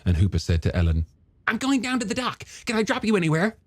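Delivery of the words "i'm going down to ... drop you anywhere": high, animated character voice; eager younger brother. Acted. Hooper greeting his brother's old girlfriend, high eager character voice. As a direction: play a keen, friendly scientist, pitched well up.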